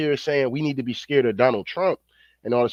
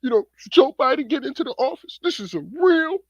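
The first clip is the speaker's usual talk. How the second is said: mocking Van Jones. Mocking Van Jones praising Biden on TV, high teary whine. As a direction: whine it way up like a pundit about to cry. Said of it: "Van Jones bitch ass always crying".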